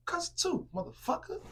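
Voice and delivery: high, mocking voice for the cursing sister; high mocking impression of a cusser; mock cussing voice. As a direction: sneer it like a crude mimic.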